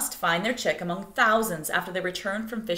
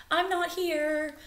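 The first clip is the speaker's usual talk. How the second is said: higher and slow, hushed; higher, slow sly fib; sneaky bird voice; 9 semitones higher, slower than usual. Acted. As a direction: pretend you're not there. Bittern's lie, quoted.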